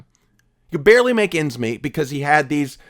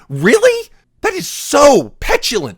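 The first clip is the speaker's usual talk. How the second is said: a very high, loud incredulous squeak; 8 semitones higher, 3 dB louder than usual. Real, not acted. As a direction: sound like you can't believe it. Incredulous rant at viewers.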